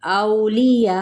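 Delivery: औलिया with a lin glide on औ, the hamza clear; tajweed demo of औलिया; recited demo. Shown as a demonstration. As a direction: show how औलिया is said. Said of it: "कैसे?".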